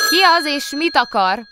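high, loud call into the phone; startled call. A narrator's reading. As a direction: shout to an unknown caller. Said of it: "kérdezte Kóbor Muki".

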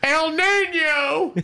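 drawn-out high El Nino in the Foley voice; Matt Foley El Nino. Retold or imitated. Matt Foley doing the Spanish bit, slow and high. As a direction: stretch it slowly, pitched high. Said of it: "I'm doing the whole Spanish version".

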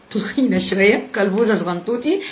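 put-on baby-talk for small learners; lightly cutesy, chubby-cheeked teacher talk; playful kiddie talk. Shown as a demonstration. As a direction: talk playfully as if to little children. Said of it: "إحنا بنتكلم كده عشان إحنا بنكلم الأطفال".

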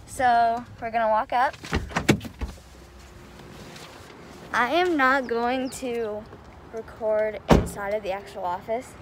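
muffled behind cloth mask; speaking through fabric; through a face mask. Real, not acted. Seen 0: cloth mask covers mouth and nose throughout labelled words.

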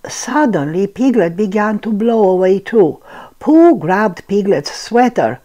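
plain reading voice

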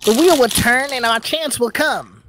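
high, loud character voice; undaunted party member. Acted. Party member reassuring the others that chance will come, high theatrical voice. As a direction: pitch it right up and declaim.

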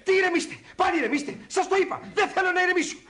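high-pitched, worked-up retort; agitated protest. Acted. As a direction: refuse to be calmed down. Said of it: "Ηρεμήστε, καημένε, ηρεμήστε". (ταραγμένη, οργισμένη διαμαρτυρία)

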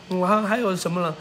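normal talking voice

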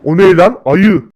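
sudden loud yelp of alarm; startled shout. Acted. Scared brother shouting at the sight of a bear.

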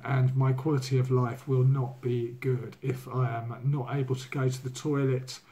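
ordinary talk, nothing marked

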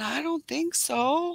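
high, drawn-out doubtful retort; slow, high-pitched polite disagreement; doubtful reply. Retold or imitated. Quoting reply to a heckler.

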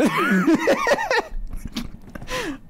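long giggle at himself; high embarrassed laugh. Real, not acted. Laughs at own answer.

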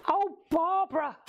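high-pitched exasperated outburst; exclaiming. Real, not acted. Host fumbling with stuff, high exclamation.

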